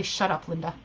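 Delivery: lightly voiced dismissive "Shut up, Linda"; a hint of brushing off an inner voice; client's mantra. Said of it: "her mantra is simply".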